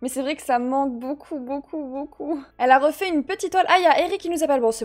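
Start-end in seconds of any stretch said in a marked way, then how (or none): none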